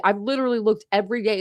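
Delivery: usual speaking voice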